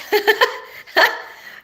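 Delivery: a high, airy burst of laughter; giggling at her own question; high breathy laugh. Real, not acted.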